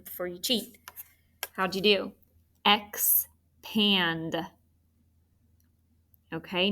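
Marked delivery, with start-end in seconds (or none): 2.7-4.4 "Expand" in two drawn-out beats, ex, pand; split into syllables. Shown as a demonstration. Teacher checking students' syllables, "Expand" split in two. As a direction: pause between "Ex" and "pand". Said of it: "so scoop the syllables".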